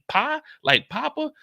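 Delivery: ordinary delivery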